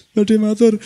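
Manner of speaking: high, timid student asking to speak; a young santri begging to speak; eager student voice. Acted. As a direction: pipe up like a shy santri asking leave.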